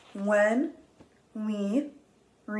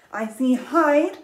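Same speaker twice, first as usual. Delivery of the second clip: excited; 6 semitones higher, 7 dB louder, slower than usual. Shown as a demonstration. Teacher reading title dramatically.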